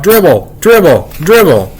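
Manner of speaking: high-pitched, mild chanting shout; childish repeated holler, not full force; children shouting. A narrator's reading. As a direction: call it out like excited little kids. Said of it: "they started to shout".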